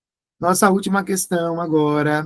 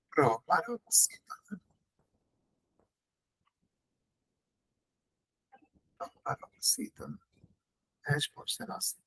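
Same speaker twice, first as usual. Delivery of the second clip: whispered aside; 12 dB quieter than usual. Real, not acted. Quiet aside to students.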